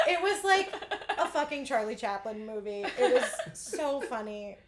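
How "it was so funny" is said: normal talking voice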